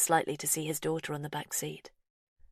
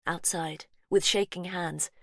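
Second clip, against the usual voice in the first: ordinary delivery, nothing marked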